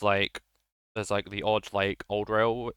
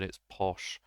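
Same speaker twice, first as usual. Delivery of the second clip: usual speaking voice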